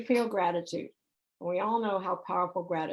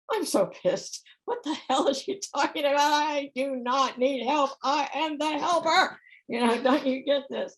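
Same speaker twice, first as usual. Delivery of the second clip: higher, louder, mock indignant rant; retold angry inner voice; 5 semitones higher, 3 dB louder than usual. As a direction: replay your own outraged thoughts, hammed up. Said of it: "I'm going".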